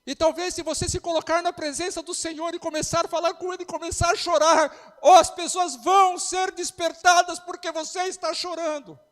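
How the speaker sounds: loud, high, rising pastor's cry; fiery preaching. Real, not acted. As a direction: preach it out to the whole church, building. Preacher building to full force.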